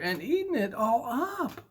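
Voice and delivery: put-on very high little baby bear voice; tiny high cub. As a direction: squeak like a tiny upset cub. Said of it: "said Baby Bear".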